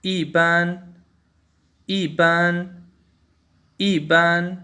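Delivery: ईबान in Mandarin tones, fourth tone then first; Chinese yiban with tone change. Shown as a demonstration. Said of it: "ई का first tone बोलते वक़्त fourth tone में".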